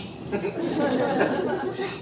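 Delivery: high wheezing burst of laughter; laughing. Real, not acted. Teller cracking up mid-joke, high wheezing laugh. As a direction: crack up at your own joke.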